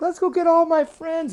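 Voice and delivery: put-on high, loud girl-frog squeal; excited screaming. A narrator's reading. Girl bullfrog to fellow riders, excited squeal. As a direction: squeal the idea out mid-ride. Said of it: "she's laughing and screaming".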